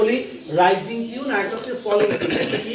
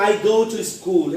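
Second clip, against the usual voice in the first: "I go to school" drawn out, falling on "school"; slow down voice; much slower than usual. Shown as a demonstration. Teacher showing a statement, slow with falling "school". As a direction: take it slowly and let "school" sink. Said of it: "এটা আমি slow বলবো".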